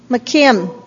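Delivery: ordinary talk, nothing marked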